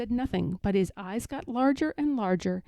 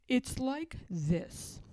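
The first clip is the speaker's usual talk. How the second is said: slow thoughtful bear; 4 semitones higher, 7 dB quieter, much slower than usual. A narrator's reading. Pooh thinking carefully.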